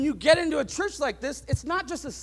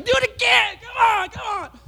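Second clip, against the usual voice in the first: higher and louder, cheerfully daring; daring taunt; 9 semitones higher, 7 dB louder than usual. Retold or imitated. Boy under water challenging his brother, loud playful dare. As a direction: egg the bully on playfully. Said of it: "if I was saying".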